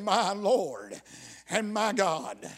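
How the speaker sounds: usual speaking voice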